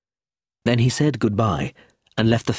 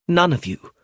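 higher, mildly annoyed retort; 9 semitones higher, 4 dB louder than usual. A narrator's reading. Clemency objecting that none understand Roger, annoyed. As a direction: cut in with annoyance.

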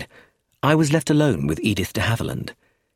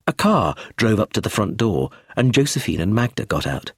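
usual speaking voice

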